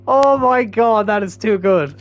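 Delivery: high, easing down after laughing; delighted amusement. Real, not acted. Gamer savouring the replay, delighted and easing down. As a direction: come down from a laughing fit.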